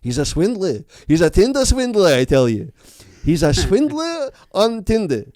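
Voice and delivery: put-on Yiddish accent, loud and high; playful Yiddish accent. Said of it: "with a Yiddish accent".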